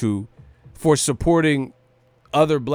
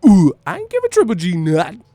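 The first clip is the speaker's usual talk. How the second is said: loud, higher-pitched rapper impression; 5 semitones higher, 6 dB louder than usual. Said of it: "Say like E forty".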